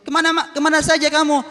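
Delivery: blind beggar voice. Acted. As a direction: ask where he has been, a touch insistent. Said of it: "katanya pengemisnya".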